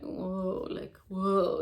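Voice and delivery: normal talking voice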